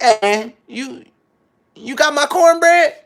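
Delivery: high loud demanding customer. Retold or imitated. Asking the restaurant staff where his cornbread went, high and loud. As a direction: pitch way up and loud, dragging it out. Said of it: "So I was like".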